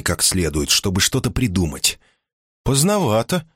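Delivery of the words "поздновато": a touch higher, mild reproach; lightly voiced wife. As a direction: gently chide him for being late. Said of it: "сказала Симона".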